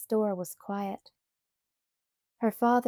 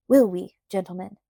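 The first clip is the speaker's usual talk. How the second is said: loud; 8 dB louder than usual. A narrator's reading. Man rallies the crowd.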